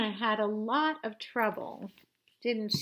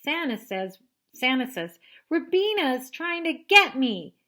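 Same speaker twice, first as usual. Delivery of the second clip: high, loud tattletale whine; little sister telling on her; whiny tattling kid; 5 semitones higher, 8 dB louder than usual. Acted. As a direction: tattle on your sister, higher and louder.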